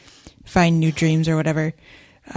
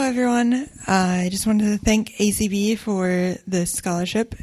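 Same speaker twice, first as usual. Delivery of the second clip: ordinary delivery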